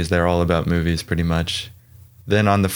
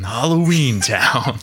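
movie trailer voice; 4 semitones higher, slower than usual. Pretend. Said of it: "I'll give it a try".

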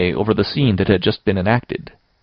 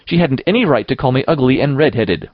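put-on higher-pitched, lightly defiant child's protest; defiant retort; 5 semitones higher, 4 dB louder than usual. A narrator's reading. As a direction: answer back in your own defense. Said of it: "evasive and defiant".

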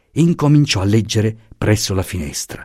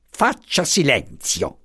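slow, softer, cold command; stern order; 6 semitones higher, 3 dB quieter, slower than usual. A narrator's reading. Commissioner orders the professor to be silent, slow and stern. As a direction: shut him up with quiet authority. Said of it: "gli intimò il commissario".

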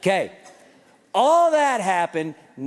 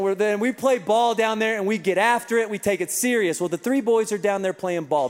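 ordinary talk, nothing marked

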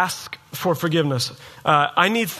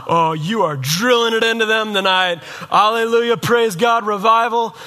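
high, loud, hammed-up hallelujah cheering; mock revival fervor; 4 semitones higher, 4 dB louder than usual. Preacher voicing a listener glad the spouse gets preached at, mock fervor. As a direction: imitate a gleeful churchgoer cheering the preacher on. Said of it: "you've been sitting here thinking".

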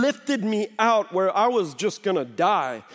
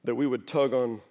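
ordinary delivery

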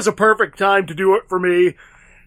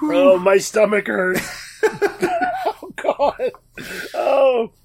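after laughing hard. Real, not acted. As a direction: catch breath after a fit of laughter. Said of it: "I haven't laughed that hard in a while".

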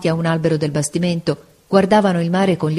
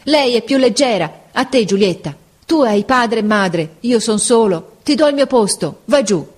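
higher, louder, urgent cry; changed voice; 5 semitones higher, 4 dB louder than usual. A narrator's reading. Mario gives Giulietta his seat, crying out. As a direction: give up his place with a cry. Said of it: "con una voce che non parea più la sua".

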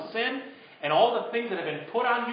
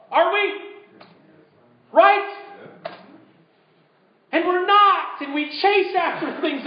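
high, loud preacher's build; rising revival preaching; preaching at full force; 8 semitones higher, 4 dB louder than usual. Acted. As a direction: drive the point home from the pulpit. Preaching climax.

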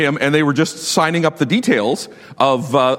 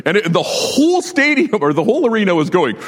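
normal talking voice

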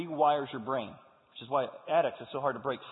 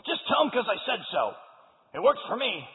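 a hint of a blunt old dad, higher and louder; gruff grandpa; 6 semitones higher, 6 dB louder than usual. Grandfather tells his son to just demand obedience, lightly mocked. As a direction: brush it off like a stubborn old father. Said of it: "his dad's like".

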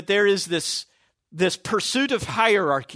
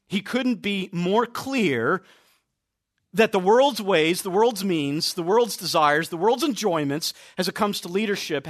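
usual speaking voice

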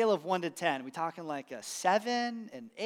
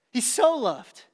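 he SO loved, with the word so drawn out and raised; stress on so; 6 semitones higher, 6 dB louder, slower than usual. Shown as a demonstration. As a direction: lean hard on so. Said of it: "He didn't just love".

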